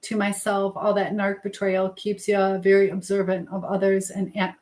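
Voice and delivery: usual speaking voice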